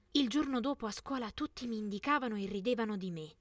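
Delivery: usual speaking voice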